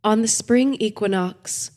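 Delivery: plain reading voice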